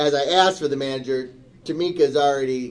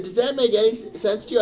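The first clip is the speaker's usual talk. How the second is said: angry retold rant; 7 semitones higher than usual. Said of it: "I'm starting to get louder".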